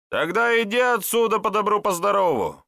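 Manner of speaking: high, loud order to clear off; stern dismissal. A narrator's reading. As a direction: send an unwanted stranger packing, pitched right up. Local man sends the stranger away. (резко прогоняя чужака, высоко и громко)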